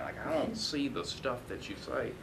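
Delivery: a friendly, quiet mock of Drew's accent; imitating Drew's accent. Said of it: "I do the imitation".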